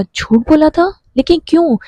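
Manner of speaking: high, rising stunned question; stunned disbelief. A narrator's reading. Shayan learns the suicide was a lie, stunned disbelief. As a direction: echo the word in shock.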